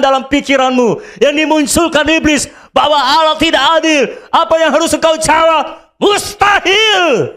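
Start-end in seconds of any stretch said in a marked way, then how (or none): 6.0-7.3 "Mustahil" shouted higher and very slow; emphatic shout. Acted. Preacher rebutting the devil's doubt, shout. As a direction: hold the shout long.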